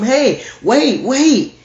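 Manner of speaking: loud, high pleading shout; desperate call. Acted. Latecomer shouts at the closed door, desperate. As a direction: cry out as the door shuts on you.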